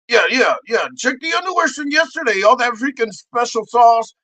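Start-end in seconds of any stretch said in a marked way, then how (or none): none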